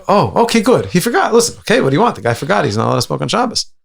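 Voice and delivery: high, eager mock reassurance; relieved elders. Elders excusing second boy, high eager reassurance. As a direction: brush it off, voice lifted high. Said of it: "look at each other and say".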